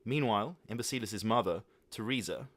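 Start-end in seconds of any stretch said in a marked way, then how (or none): none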